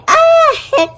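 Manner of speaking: a loud high hiccup with a little cry. Acted. Girl hiccuping as the boy runs away, high hiccup. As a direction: blurt a squeaky hiccup.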